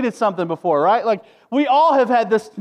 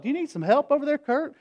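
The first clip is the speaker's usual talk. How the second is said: helpful neighbor; 7 semitones higher, 4 dB quieter, faster than usual. Retold or imitated.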